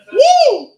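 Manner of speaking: high, very loud "woo"; loud whoop. Real, not acted. Comic whooping mid-set, loud and high. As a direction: let out a big high whoop.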